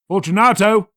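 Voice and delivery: high, loud call into the niche; calling aloud. A narrator's reading. Montresor calling to Fortunato through the wall, loud call. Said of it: "I called aloud".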